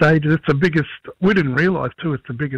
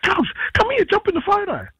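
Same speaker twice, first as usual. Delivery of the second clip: high, eager call to a cousin. Retold or imitated. Spotting a cousin and wanting a photo, calling out. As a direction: beckon someone over in a raised pitch.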